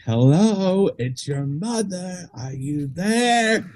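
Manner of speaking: high, drawn-out motherly phone greeting. Retold or imitated.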